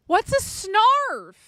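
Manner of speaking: drawn-out, high, loud disbelief; baffled. Real, not acted. Streamer baffled by chat's word, high and slow.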